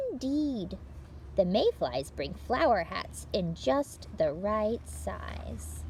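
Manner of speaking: bright, lively read-aloud for little listeners; picture-book reading. Acted. Librarian reading a picture book to children, lively read-aloud. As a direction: tell it like story time for small children.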